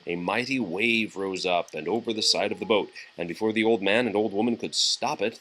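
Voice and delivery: usual speaking voice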